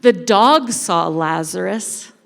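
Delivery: plain reading voice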